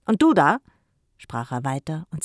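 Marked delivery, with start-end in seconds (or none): 0.0-0.6 put-on higher, much louder jab at the girl; single her out with a bark; sharp challenge. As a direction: uncle rounding on the reading girl, loudly. Uncle turns on Lilly.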